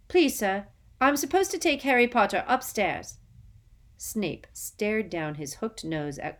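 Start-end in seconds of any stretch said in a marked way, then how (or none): 0.1-3.2 higher, louder, timid pleading; nervous schoolboy asking politely; eager young boy. Acted. Eager boy asks teacher.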